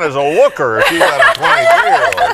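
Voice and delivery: high, loud, laughing banter; laughing tease. Real, not acted. Old guy teasing Ann about her looks, laughing and loud. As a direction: rib her while chuckling.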